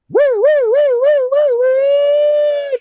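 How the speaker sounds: very high loud wailing woo; siren imitation. State troopers arriving, loud siren imitation.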